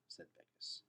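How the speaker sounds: plain reading voice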